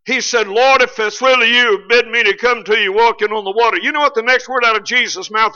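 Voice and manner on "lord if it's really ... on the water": silly little prayer. Retold or imitated. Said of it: "Peter prayed what I call a silly little prayer".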